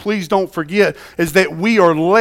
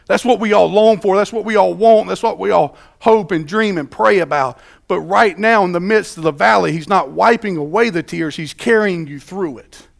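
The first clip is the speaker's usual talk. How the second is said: preaching build; 4 semitones higher, 3 dB louder than usual. Acted.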